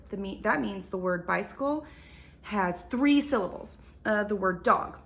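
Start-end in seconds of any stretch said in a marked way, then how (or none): none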